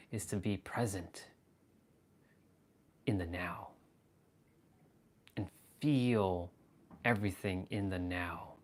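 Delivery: low, hushed, measured delivery with long pauses; quiet guru-like murmur; intense spiritual address. Shown as a demonstration. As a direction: speak softly and intently, letting each phrase sink in.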